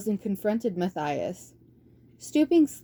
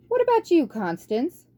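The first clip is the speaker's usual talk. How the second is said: much higher, quavering elder; council elder; 9 semitones higher, 3 dB louder than usual. Acted. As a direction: ask kindly from on high. Council elder asks badger.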